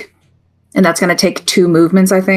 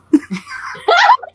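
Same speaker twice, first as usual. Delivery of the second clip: high laugh. Real, not acted. Laughing at the undo button.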